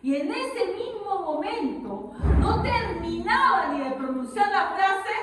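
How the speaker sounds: high, loud dramatic storytelling; forceful preaching. Acted. Preacher tells the king's sudden downfall, loud and forceful. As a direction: hammer out the turn of the story.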